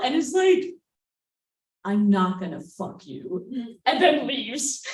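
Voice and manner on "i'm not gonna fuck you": low, slow, deliberate refusal; mock-menacing date. Date pinning her to wall, slow and intimidating. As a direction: drop low and draw it out as the date.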